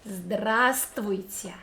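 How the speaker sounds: Здравствуйте slowly, the first "в" dropped, like "здраствуйте"; without the v. Shown as a demonstration. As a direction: skip the silent letter in Здравствуйте. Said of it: "pronounce this word without this letter".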